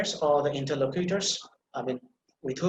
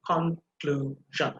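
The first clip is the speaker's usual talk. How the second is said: drawn out, syllables pulled apart; slow syllable-by-syllable; much slower than usual. Shown as a demonstration. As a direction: stretch each syllable slowly. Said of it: "Pronounce it, I mean, with the emphasis".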